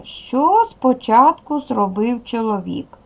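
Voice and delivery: slow, deliberate classroom read-out of the question; slow model reading. Shown as a demonstration. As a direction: read it out slowly for pupils, stretching the words. Teacher models reading, then repeats.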